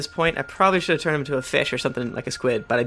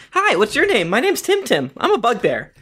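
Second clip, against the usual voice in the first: lightly voiced chirpy little monster, higher; baby bugbear; 6 semitones higher than usual. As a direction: introduce yourself like an eager baby creature. Introduces a baby monster character in voice.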